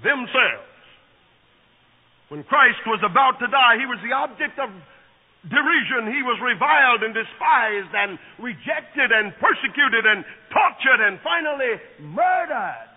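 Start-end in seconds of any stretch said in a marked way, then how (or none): 2.3-12.9 louder, building preacher cadence; preaching at full force. Acted. Preacher to congregation, building fervour. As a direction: pile up each verb with rising force.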